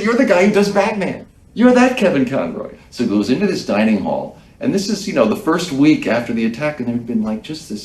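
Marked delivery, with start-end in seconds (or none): 0.0-2.7 excited fan. Retold or imitated. Volunteer recognizes the voice actor.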